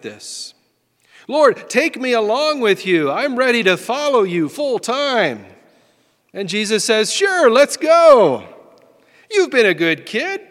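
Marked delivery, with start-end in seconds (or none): 1.3-5.5 high, loud, keen pledge; hammed-up enthusiasm, pitched up; eager volunteer. Acted eager would-be follower of Jesus. 7.1-10.4 big hammed-up encouragement; hearty agreement. Jesus acted out, agreeing heartily.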